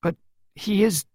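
slow, high, doubtful question; anxious child. A narrator's reading. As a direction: ask fearfully, spacing the words. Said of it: "Pippa asked".

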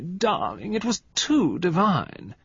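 high, drawn-out society gush; gushing cry. A narrator's reading. Valentine gushing to her husband Tony, high and slow. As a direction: swoon over the evening, slowly. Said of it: "cried Valentine".

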